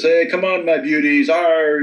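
high-pitched imitation of a gleeful gunner; playful mock battle cry, pitched well up; Adrian's battle ad lib. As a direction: call out a roguish taunt, ending in a drawn growl "arr". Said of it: "and he does this".

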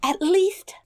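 high, dramatic storybook dismay; distressed princess. A narrator's reading. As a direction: wail the question as a high-voiced princess. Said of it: "continued she".

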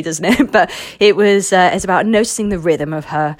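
ordinary delivery

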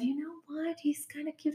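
hushed aside to her friend; soft quoted friend. Retold or imitated. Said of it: "And my friend said".

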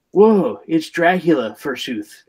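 put-on overdone old-English stage voice; hammy stage actor. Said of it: "His Brooklyn accent is to Mario".

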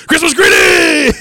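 dad doing the take again; repeated stiff shout. Retold or imitated. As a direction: shout it again through the giggles. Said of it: "And he does it again".

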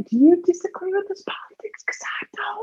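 breathy secret whisper. Acted. Conspiratorial whisper.

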